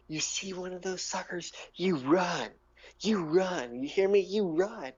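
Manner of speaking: high, insistent warning voice; urgent warning. Acted. Gnome warning adventurers to flee drow, urgent high voice. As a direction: push each command up high, with urgency.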